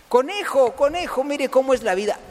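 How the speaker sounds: high, insistent call. Acted. Trapped boy calls the passing rabbit, calling out. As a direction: hail them high, then keep the question up there.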